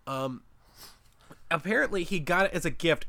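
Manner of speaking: ordinary talk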